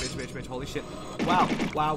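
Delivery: ordinary delivery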